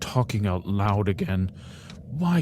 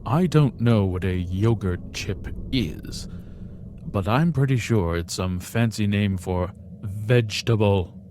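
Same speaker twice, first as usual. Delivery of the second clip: ordinary delivery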